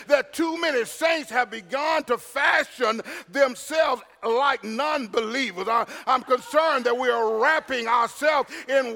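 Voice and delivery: loud, high-pitched pulpit delivery; ringing sermon voice with amens; full-force preaching. Acted. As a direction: preach it hard, punching the key words.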